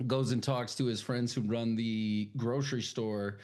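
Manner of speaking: ordinary delivery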